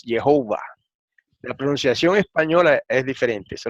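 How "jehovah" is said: Jehová said the English way, "ye-HO-va"; Jehová anglicised, with a long stressed O; English pronunciation. Shown as a demonstration. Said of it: "pronunciado en inglés".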